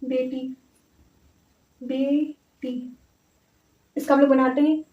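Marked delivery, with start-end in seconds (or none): 0.0-3.0 softly drawn-out syllables; quiet, stretched, syllable by syllable; slow dictation. Real, not acted. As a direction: say it while slowly writing it. Saying the word while writing it.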